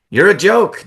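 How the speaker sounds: loud, high scornful jab; gloating taunt. Acted. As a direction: spit the insult down at him. Sami taunting Jay.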